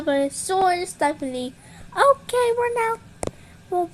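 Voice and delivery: ordinary talk, nothing marked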